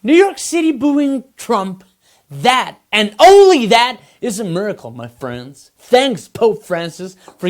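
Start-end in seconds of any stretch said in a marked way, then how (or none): 2.9-4.0 only punched loud with a rise; mock solemn emphasis on only; stress on only. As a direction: lean hard on only. Mock miracle proclamation.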